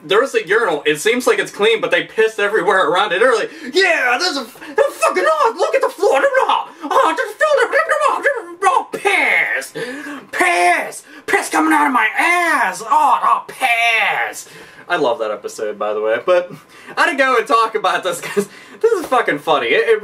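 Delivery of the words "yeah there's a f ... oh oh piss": much higher laughing, shouting freakout; hysterical disgust. Pretend. Man in a filthy public restroom freaking out, much higher laughing shouts. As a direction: shriek and cackle over the pissed-on floor. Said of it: "it's just like".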